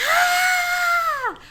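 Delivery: very high, loud, sustained shriek; piercing acted-out squeal; mock scream. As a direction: scream it at full volume. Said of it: "the volume's turned up".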